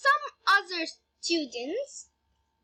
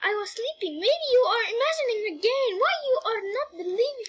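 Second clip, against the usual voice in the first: higher, mildly indignant protest; 6 semitones higher, faster than usual. A narrator's reading. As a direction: argue back in a higher voice. Brother argues in the story.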